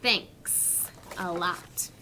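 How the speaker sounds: Thanks a lot drawn out slowly, each sound placed; unhurried speech-practice model; slow careful demo. Shown as a demonstration. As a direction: spell out Thanks a lot slowly for kids to copy. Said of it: "Remember, Bear says".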